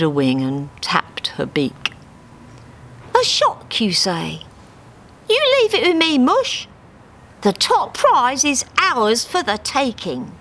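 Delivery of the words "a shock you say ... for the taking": high, sly cockney duck; Harry scheming. Acted. Harry hatching a plan for Dave, sly and high. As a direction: say it with a plotting glint.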